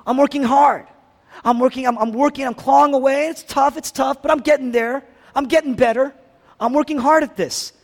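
self-satisfied religious person insisting they are getting there; striving religious person. Retold or imitated. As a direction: push it out like someone clawing uphill. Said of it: "the religious people, they say".

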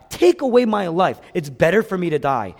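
dramatic plea. Retold or imitated. Pastor quoting Jonah who wants to die, dramatic plea. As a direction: cry out as if done with living. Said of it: "He wants to die".

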